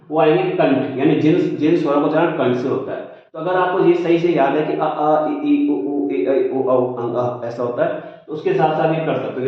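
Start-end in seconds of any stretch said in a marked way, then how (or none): none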